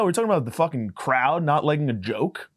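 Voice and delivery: ordinary delivery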